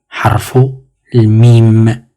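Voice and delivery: Harful mim drawn out slowly; slow model pronunciation. Shown as a demonstration. Teacher models Harful mim slowly for the class. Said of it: "La lettre M".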